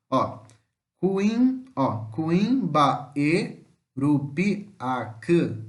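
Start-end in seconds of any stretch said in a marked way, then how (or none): 1.8-5.6 cuimbaerupiac stretched out, ui together in one syllable; slow demo of the diphthong in cuimbaerupiac. Shown as a demonstration. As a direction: drag cuimbaerupiac out slowly. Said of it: "Temos duas vogais na mesma sílaba".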